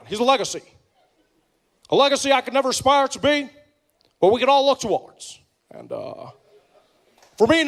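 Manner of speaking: measured presidential speech with pauses; grand, halting speech-giver cadence; Obama impression. Impersonating Obama's memorial speech.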